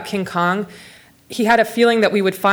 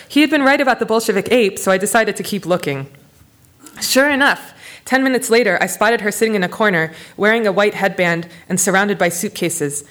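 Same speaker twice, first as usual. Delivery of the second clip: reading a memoir passage aloud; steady narrator voice with voiced quotes; story reading; 3 semitones higher than usual. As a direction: read it to an audience, lightly voicing the people. Author reads her book aloud.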